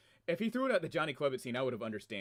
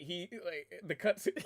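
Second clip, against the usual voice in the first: normal talking voice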